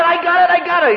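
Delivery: very high, loud eager yelps; hammed-up sudden eagerness; eager mock excitement. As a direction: squeal it like a man remembering. Retold speaker quote.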